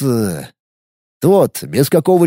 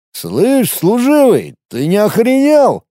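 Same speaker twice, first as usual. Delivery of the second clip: put-on half-drunk; 7 semitones higher, slower than usual. A narrator's reading. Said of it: "полупьяным голосом произнёс он".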